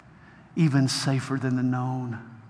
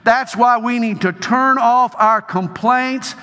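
loud, high preaching at full force; forceful preaching; 5 semitones higher, 4 dB louder than usual. Acted. Preacher exhorting the crowd, loud fervent preaching. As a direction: drive it home to the congregation.

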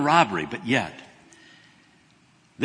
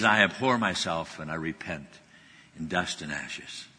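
much lower and softer, sinking into dust; repentant; 8 semitones lower, 8 dB quieter than usual. Retold or imitated. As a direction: let the voice fall away in shame. Job repenting in quoted prayer.

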